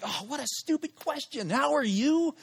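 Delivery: high-pitched exasperated self-scolding; mock your own words in a high, exasperated run; beating himself up. Scolding himself for asking a dumb question, exasperated. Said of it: "I'm just beating myself up".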